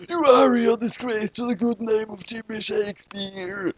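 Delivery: high, slow mock-furious Kira; hammed-up outraged yell at the failed shinigami; mock scream. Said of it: "he screamed".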